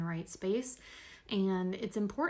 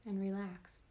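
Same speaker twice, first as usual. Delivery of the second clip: guided meditation; 5 dB quieter than usual. Real, not acted. Host guiding listeners through a body scan, calm meditation voice. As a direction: talk them gently through the body, unhurried.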